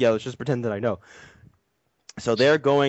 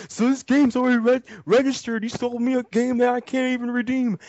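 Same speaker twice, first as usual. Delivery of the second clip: much higher, mock-whiny buyer voice; mock whiny customer; 13 semitones higher than usual. Storyteller imitating a buyer complaining to GameStop, high and whiny. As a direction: squeak it out like an indignant customer.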